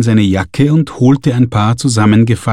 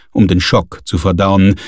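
ordinary delivery

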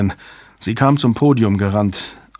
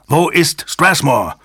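higher, drawn-out angry question; angry demand; 6 semitones higher, 3 dB louder, slower than usual. A narrator's reading. Jabba wheels round on the newcomers, angry demand. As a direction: demand it like an enraged bull. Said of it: "wie ein wütender Stier".

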